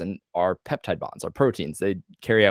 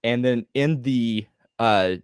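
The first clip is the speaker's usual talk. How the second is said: normal talking voice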